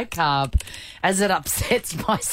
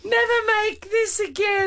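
high, pitched-up wail on Never; hammed-up indignant cry; mock warning. Repeating warning.

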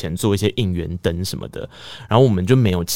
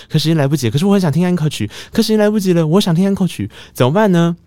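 put-on a frantic teen torn between the encore and getting home; escalating fluster, pitch rising step by step; rising panic; 9 semitones higher, 4 dB louder than usual. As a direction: voice climbing higher and louder with each line.

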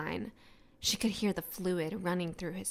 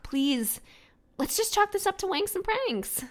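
nervous pleading; 12 semitones higher than usual. Acted. Pleading to villain.

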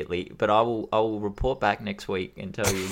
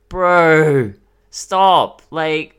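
exasperated outburst; 6 semitones higher, 10 dB louder, much slower than usual. Real, not acted. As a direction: cut him off in disbelief. Exasperated outburst at [name].

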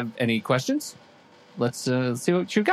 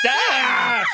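scream; 11 semitones higher, 4 dB louder than usual. Acted. Scream again.

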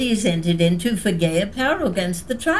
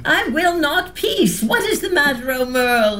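defiant demand; 6 semitones higher than usual. Acted. Wife defying husband.

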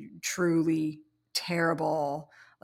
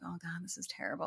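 much softer, quick hushed dismay; 11 dB quieter, faster than usual. Retold or imitated. Designer seeing the stiff samples, hushed fast dismay. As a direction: mutter it so the developer can't hear. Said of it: "And I'm like".

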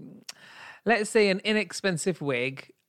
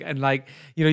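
ordinary delivery

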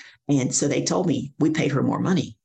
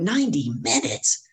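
high, slow, rising question; incredulous echo; 7 semitones higher, slower than usual. Retold or imitated. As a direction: stretch it out in astonishment. Echoing the length in shock.

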